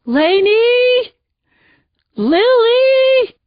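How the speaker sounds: high, loud heart-rending howl of names; anguished screaming. Acted. Husband screaming his wife's and daughter's names. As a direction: scream for them in terror. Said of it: "the screaming started".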